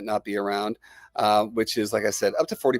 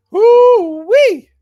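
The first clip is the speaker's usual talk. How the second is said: loud high whoop; excited whoop; 13 dB louder than usual. Real, not acted. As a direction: let out a big joyful holler. Big cheer in the livestream.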